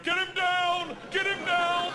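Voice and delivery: high, loud repeated order; urgent mock yell. Sound guy orders the panicking comic restrained, loud mock yell. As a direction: holler it like a battle command.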